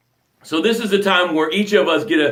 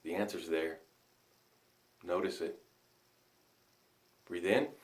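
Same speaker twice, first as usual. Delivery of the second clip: very soft, much lower breath guidance; guided breathing; 11 semitones lower, 22 dB quieter than usual. Acted. Coach guiding the tribe through a breath, very soft. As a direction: ease them through the breath, hushed.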